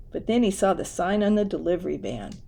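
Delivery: ordinary delivery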